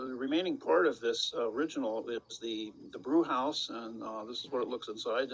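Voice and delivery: muffled and far from the mic; faint audio. Real, not acted. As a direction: sound faint and distant, like bad call audio. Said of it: "'cause you sound very faint all of a sudden".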